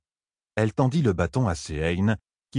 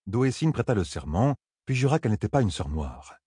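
ordinary delivery

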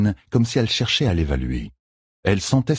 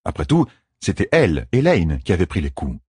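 usual speaking voice

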